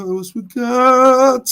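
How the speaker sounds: stretched out high and loud; slow, soaring call to the chat; playful drawn-out call. Real, not acted. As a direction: end the repeated question on a long, high holler. Playfully repeats phrase while scanning chat.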